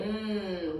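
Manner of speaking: "mm" as a held hummed N sound, nnn; "mm" drawn out as one consonant; final consonant sound. Shown as a demonstration. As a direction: hold "mm" as the isolated end sound of con. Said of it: "it ends with".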